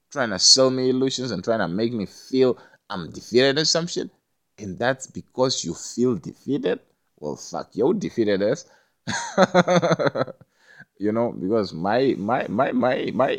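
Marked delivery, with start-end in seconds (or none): none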